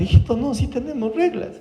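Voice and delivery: high, softened mock alarm; horrified father. Papá espeluznado corrige al hijo en terapia, alarma imitada. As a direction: imitate a dad hastily correcting his child. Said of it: "el papá se espeluzna y dice".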